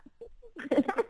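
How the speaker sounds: bright high giggle. Real, not acted. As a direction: giggle at the greeting. Greeting friend on the call.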